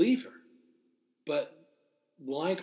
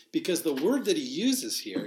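usual speaking voice

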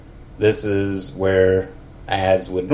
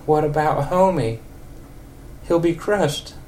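put-on high wavering old lady voice; 7 semitones higher than usual. Old lady Marge answers Ned after their night. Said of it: "old lady for Marge".

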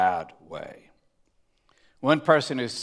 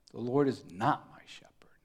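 whispered aside; 6 dB quieter than usual. Shown as a demonstration. Mock misquote of the psalm.

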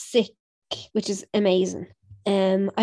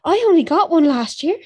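proud; 8 semitones higher, 7 dB louder than usual. Real, not acted. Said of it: "You said it like it was a Christmas gift".

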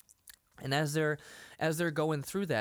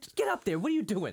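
high, raised-voice mock scolding; retold exasperated scolding; 7 semitones higher, 4 dB louder, faster than usual. Retelling a man telling off a tickler, mock exasperation. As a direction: scold in a much higher, louder voice.

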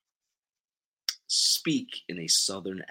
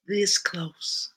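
high, voiced Southern lament; pained Southern emphasis, pitched up; Southern accent; 10 semitones higher than usual. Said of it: "in your best Southern accent".

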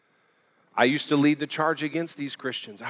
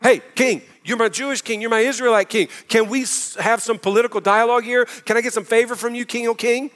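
higher, pleading mock whine to the king; mock begging; 8 semitones higher, 5 dB louder than usual. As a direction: beg the king like a hammed-up petitioner. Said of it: "we'd go".

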